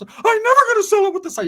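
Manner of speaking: very high, loud cartoon squeak. [name] squealing at his cousin in a Mickey Mouse voice. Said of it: "from Mickey Mouse to [name]".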